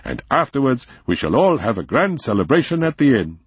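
eager, lifted voice; excited. A narrator's reading. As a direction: promise a party with glee. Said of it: "he said excitedly".

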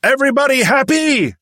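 high, loud yelled question; jumpmaster barking cues. A narrator's reading. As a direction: yell it over the engines. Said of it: "the jump master yelled".

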